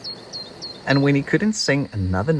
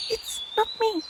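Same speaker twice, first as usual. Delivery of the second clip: tiny squeak, much higher and softer; squeaking mouse; 5 dB quieter than usual. A narrator's reading. Mouse squeaking a reply to Peep. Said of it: "squeaked the mouse".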